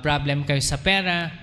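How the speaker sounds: ordinary talk, nothing marked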